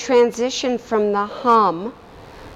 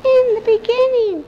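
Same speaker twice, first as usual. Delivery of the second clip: high tone; 14 semitones higher than usual. Shown as a demonstration. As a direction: lift the voice up high for this phrase. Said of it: "You can try shifting the tone".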